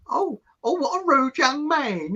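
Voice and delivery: high, slow mock-offended lady; offended old lady. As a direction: huff like an affronted old woman.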